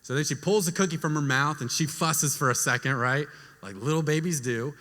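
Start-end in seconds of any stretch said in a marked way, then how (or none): none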